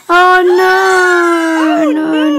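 sudden high, loud cry of dismay; alarmed shout. Real, not acted. Kid watching a fail video, alarmed shout. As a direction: yelp it out in shock.

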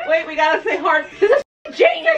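high loud delighted shouting; laughing excitement; excited shout. Real, not acted. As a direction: yell it out, thrilled. Laughing at a funny video.

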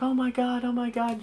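put-on high falsetto panicking girl; hammed-up falsetto oh-my-Gods; mock freaking out. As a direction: imitate her panic in a squeaky high voice. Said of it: "She's, like, freaking out".

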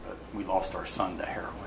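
very soft, low, almost whispered; hushed confession. Retold or imitated. Mother confiding her son's death to a stranger, hushed and low. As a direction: let the voice sink nearly to nothing. Retold grieving parent's confession.

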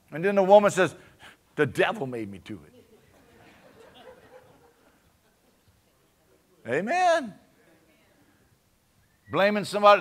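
loud, high, impassioned preaching; full-force preaching. Acted. Pastor fires up the church about sin and mercy, loud high preaching. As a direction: build it to a shouting climax.

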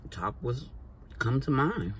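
words around a mouthful; chewing between phrases; talking while chewing. Real, not acted. Seen 0: Cheek bulges and pursed lips while chewing up to 4.4s; food held later.

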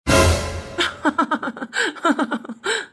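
long, low run of chuckles; mocking laugh. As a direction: keep laughing in bursts, without remorse. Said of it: "சிரிச்சுக்கிட்டு இருக்க". (விடாத கேலிச் சிரிப்பு)

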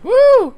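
high, loud "woo"; celebratory whoop. Real, not acted. Player cheers after unlocking content, high whoop. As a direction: whoop with delight at the win.

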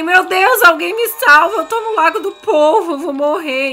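mock panic. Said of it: "Aí eu fiquei".

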